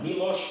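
Milos as MEE-losh with a rising end; correct pronunciation. Shown as a demonstration. Vlogger repeating the name for viewers, correct MEE-losh. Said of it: "'cause the I is an E sound".

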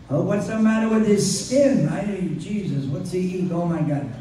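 lightly voiced high complaining mother. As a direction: grumble it like a fussy mother. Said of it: "she's complaining".

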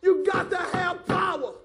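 slow, high, loud pulpit shout; booming, drawn-out exhortation; full-force preaching. Real, not acted. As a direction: cry it out to the whole congregation.

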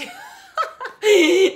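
high, loud burst of real laughter; real laughter breaking out. Real, not acted. Laughing at friend's panic.

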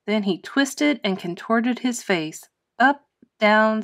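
ordinary delivery

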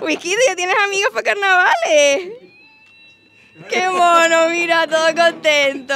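high, loud, excited squeal of delight; delighted gushing. Real, not acted. Host telling Whiskito he has carnival friends, delighted gushing. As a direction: gush as if over an adored pet.

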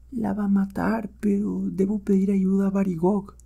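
high, hushed anxious voice; fearful old Guk. Acted. Old Guk flees and decides to call for help, fearful. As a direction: fret to yourself, soft and high.

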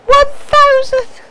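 slow, loud and high; outraged disbelief. Real, not acted. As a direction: stretch it out in shock. Reads price, outraged.

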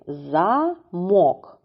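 Замок split into За and МОК; Замок drawn out as za, MOK; syllable by syllable. Shown as a demonstration. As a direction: break Замок in two, weight on мок. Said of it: "Поділимо слово на склади".

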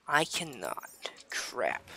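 whispered, slow; whispering. Acted. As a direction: whisper it to yourself.

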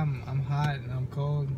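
usual speaking voice

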